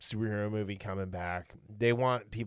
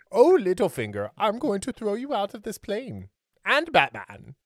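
sneering panto villain; 10 semitones higher, 8 dB louder than usual. Retold or imitated. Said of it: "he's just like".

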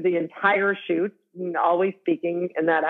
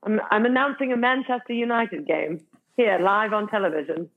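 British accent, like introducing a live football broadcast; British sports announcer; 3 semitones higher than usual. Shown as a demonstration. Actor playfully announcing a Manchester United game, British accent. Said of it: "sounds like you were announcing".

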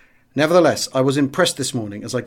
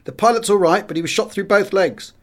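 a narrator's raised call across the field; 7 semitones higher than usual. A narrator's reading. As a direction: shout the news over from a bike. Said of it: "called out to us".

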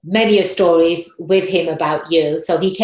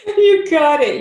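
excited; 10 semitones higher, 6 dB louder, slower than usual. Real, not acted. Excited reply to joke.

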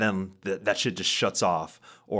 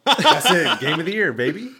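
excited laughing shout; 9 semitones higher, 8 dB louder than usual. Real, not acted. Hyping up the game, laughing.

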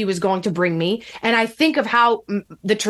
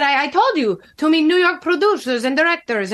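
higher-pitched mock Russian accent; bad Russian accent; 5 semitones higher than usual. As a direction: insist in a put-on Russian accent. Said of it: "sorry for the bad accent".

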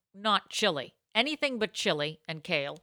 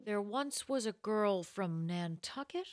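slow, soft limerick with questioning lilt; serious broadcaster ending on a question mark; Keith Morrison; 6 dB quieter than usual. Retold or imitated. Mocking a condescending broadcaster reciting a limerick, questioning tune. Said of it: "Everything has a question mark at the end".

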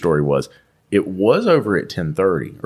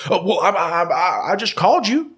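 higher, stammering excuse in a put-on voice; mock flustered advisor; 11 semitones higher than usual. Imitating the service advisor stammering to a customer, higher. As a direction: play the caught-out advisor fumbling an excuse. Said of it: "got this thing he does".

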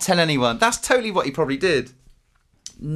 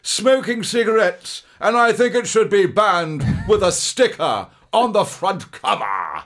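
put-on slow, louder, higher booming old actor; Brian Blessed impression; 4 semitones higher, 3 dB louder, slower than usual. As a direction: boom it out like a hammy stage actor. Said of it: "You want to make Brian Blessed".

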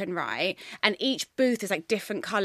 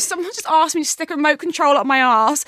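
exasperated retelling; 7 semitones higher, 8 dB louder than usual. Retold or imitated. Quoting a shocked call.

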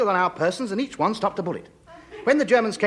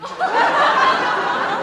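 nervous laugh; 6 dB louder than usual. Acted. Panic at red caps.

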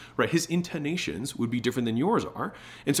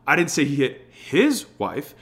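HIS drawn out and loud; single out HIS with a heavy beat; stress on HIS; 2 semitones higher, 5 dB louder, slower than usual. Shown as a demonstration. Sales coach shows how emphasis changes meaning, stress on HIS. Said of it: "the emphasis that we put on the words".

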